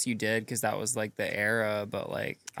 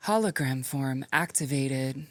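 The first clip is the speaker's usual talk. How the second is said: feminine robot voice; 3 semitones higher, slower than usual. Pretend. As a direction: speak as a calm female robot assistant. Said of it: "kinda robot voice I've been working on".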